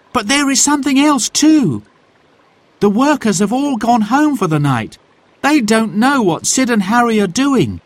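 put-on high, eager boy's voice; child voice. A narrator's reading. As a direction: voice Jack as a young boy. Child Jack voice.

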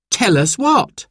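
put-on high, questioning child's voice; puzzled children. A narrator's reading. Children question their parents, puzzled and high. Simon and Sarah looked puzzled.

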